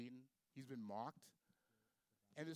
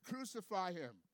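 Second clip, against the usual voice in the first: raised, louder crowd cry; higher, louder cry from the crowd; crowd's cry; 6 semitones higher, 6 dB louder, slower than usual. Acted. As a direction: call it out as an angry crowd's demand. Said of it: "they all answered".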